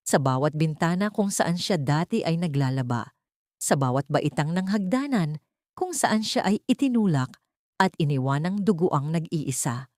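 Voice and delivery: usual speaking voice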